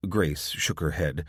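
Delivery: usual speaking voice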